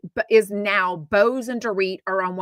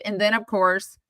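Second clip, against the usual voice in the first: normal talking voice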